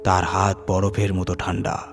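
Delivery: ordinary delivery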